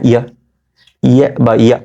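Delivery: normal talking voice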